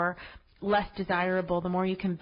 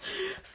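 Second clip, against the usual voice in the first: short, high giggle at herself; a quick laugh at the slip; embarrassed laugh; 12 semitones higher, 9 dB quieter than usual. Real, not acted. Podcaster laughs at her own accent slip.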